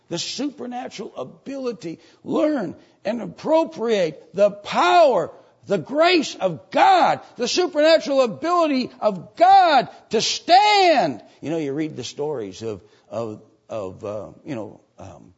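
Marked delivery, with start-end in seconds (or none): 0.1-11.2 full-force preaching. Real, not acted. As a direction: hammer each phrase out at the top of your voice.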